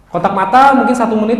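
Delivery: ordinary talk, nothing marked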